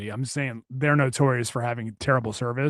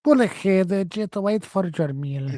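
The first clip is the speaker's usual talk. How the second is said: mock worker with accent; 3 semitones higher than usual. Imitating the worker ordering him to pull ahead, accented. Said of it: "worker impression again".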